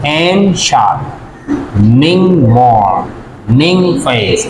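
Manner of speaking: "and shining morning face" slowly grouped in pairs: and-shi, ning-mor, ning-face; two syllables at a time. Shown as a demonstration. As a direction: pause after every second syllable of "and shining morning face". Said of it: "दो syllables".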